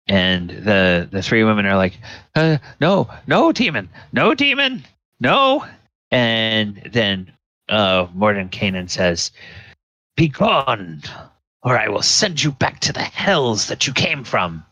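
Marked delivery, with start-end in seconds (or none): 2.4-5.7 high squealed protests, rising in pitch; hammed-up high-voiced panic; panicked witches. Three women panic before the wizard. 10.2-14.6 raised-pitch theatrical banishment; imperious wizard's warning; commanding wizard. Acted. Mordenkainen banishes the women with a threat.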